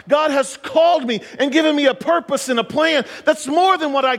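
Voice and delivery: high-pitched, urgent sermon delivery; impassioned preaching. Acted. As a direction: preach it in a rising, insistent high register. Preaching builds to full force.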